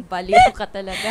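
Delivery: laughing while calling her crazy; giggling, playful jab; laughing tease. Acted. Laughing at friend's silly remark.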